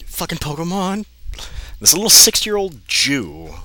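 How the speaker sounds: high, loud cry of disbelief; exasperated outburst. Real, not acted. Reader yells at the absurd character, exasperated. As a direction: yell it out, fed up.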